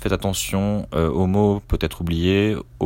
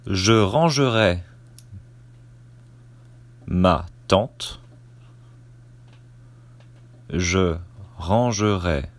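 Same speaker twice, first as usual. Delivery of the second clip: dictating. Shown as a demonstration. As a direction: dictate it chunk by chunk, naming the punctuation. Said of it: "Je commence la dictée".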